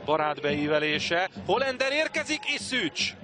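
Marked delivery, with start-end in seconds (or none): 1.5-3.1 excited hungarian sports commentary. Real, not acted. Attack build-up in live commentary.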